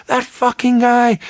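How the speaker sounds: high, slow, exasperated mock fury; exasperated outburst. Engineer fuming about the night clerk, exasperated. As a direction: drag it out in disbelief. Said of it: "He goes".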